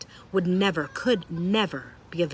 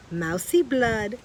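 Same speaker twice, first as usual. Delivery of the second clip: higher, louder, slow gloating call; rat shout; 6 semitones higher, 5 dB louder, much slower than usual. A narrator's reading. Rat shouting for blood, higher and louder. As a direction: call out hungrily. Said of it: "shouted one rat".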